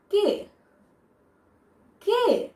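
কে high and falling, with an "eh" vowel; demonstrated pronunciation. Shown as a demonstration. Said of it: "উচ্চারণটা আমি কিন্তু এভাবে করছি".

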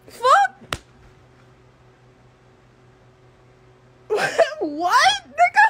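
high laughing disbelief; incredulous laugh. Real, not acted. Laughing outburst at her age.

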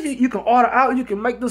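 high, insistent mock woman voice; high girlfriend voice. Girlfriend listing options to her boyfriend, high voice. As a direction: list the options like she did. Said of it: "she like".

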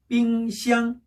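"삥샹" slow, two level held notes; double first tone. Shown as a demonstration. Teacher drilling fridge for students, "삥샹" slow level tones. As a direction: stretch "삥" and "샹" without moving pitch. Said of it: "항상 이 톤이어야 돼요".

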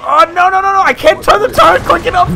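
high, loud frantic yelling; panicked shouting. Real, not acted. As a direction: yell in real panic. Tank under air attack.

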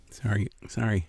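breathy whisper, sorry sorry; whispered apology. Real, not acted. Vlogger whispering a teasing sorry to viewers while eating.

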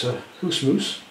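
Scottish "hoose moose" with oo vowels; light playful Scottish accent. Shown as a demonstration. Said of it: "in the Scottish accent".

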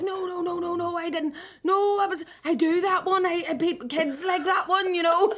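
high-pitched, panicky imitation of backpedalling; flustered mock denial. Embarrassed balloon artist explains himself to a customer, flustered and high.

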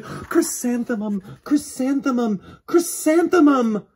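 put-on girl's happiness building to a high loud cry; overjoyed. A narrator's reading. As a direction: bloom with delight on each name. Chrysanthemum joyful.

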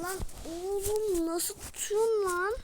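high, slow exasperated shout; frustrated yell. Real, not acted. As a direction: yell at the keeper in disbelief. Frustrated at the goalkeeper's save.

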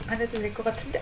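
ordinary delivery

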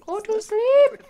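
loud, high "Go to sleep!"; exasperated bedtime yell; mock yell. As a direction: shout it at the toddler. Yelling at awake toddler.

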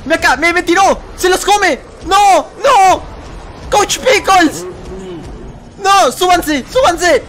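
high, urgent yells; high-pitched bursts of alarm; panicked shouting. Real, not acted. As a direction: shout frantically to save them. Yelling as game characters are eaten.

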